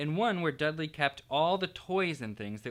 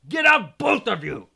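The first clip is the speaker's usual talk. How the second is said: loud, high furious order; croaked; 8 semitones higher, 13 dB louder than usual. A narrator's reading. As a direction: force the words out in anger. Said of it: "croaked Uncle Vernon".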